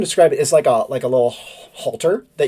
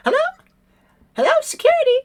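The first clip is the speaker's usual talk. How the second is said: very high chirpy phone call; high bird voice on the phone; bird lady on the phone; much faster than usual. Acted. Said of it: "and she's like".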